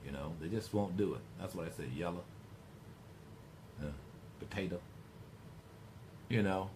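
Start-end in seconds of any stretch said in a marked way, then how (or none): 2.0-2.2 "yellow" with the final O cut short to "yella"; quiet, low "yellow" ending in a lazy "uh"; Southern drawl shortcut. Shown as a demonstration. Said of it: "That's what the drawl is. It's kind of a shortcut". 4.4-4.8 "potato" ending in "uh" instead of O; drawled "potato" with the last O dropped; Southern drawl shortcut. Shown as a demonstration. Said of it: "That's what the drawl is. It's kind of a shortcut".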